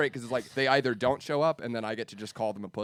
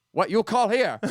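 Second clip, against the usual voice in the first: put-on high, loud, confused Chinese-accented voice; hammed-up Chinese accent, indignant; mock Chinese accent; 11 semitones higher, 8 dB louder, slower than usual. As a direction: play a baffled restaurant worker with Chinese accent. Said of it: "make two Chinese restaurants call each other".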